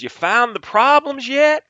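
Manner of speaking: slow, high, loud Southern drawl; gruff Southern CEO voice; Southern accent. Retold or imitated. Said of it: "I'm gonna fake a Southern accent".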